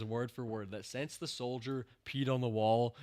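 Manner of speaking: usual speaking voice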